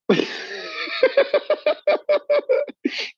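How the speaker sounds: long high burst of laughter. Real, not acted. Wrapping up the story, cracking up laughing.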